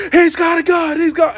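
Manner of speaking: high, loud panicked shout; frantic high shout of alarm; retold actor yelling. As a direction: yell it like an actor in a scene. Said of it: "like, yelling".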